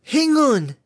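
high, loud call across a distance; shout. Acted. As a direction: shout it to a friend far off. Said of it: "she shouted".

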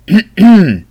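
pointed, loud throat-clear. A narrator's reading. Brother prompts his sister on his back, a pointed throat-clearing. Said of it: "прокашлялся он".

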